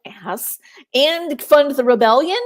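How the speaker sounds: hammed-up protest, pushing each point; mock exasperation. Voicing the mother about the introduction, loudly exasperated.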